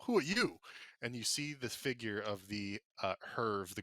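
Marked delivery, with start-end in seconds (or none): 0.0-0.6 angry groundskeeper. Acted. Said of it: "and it goes".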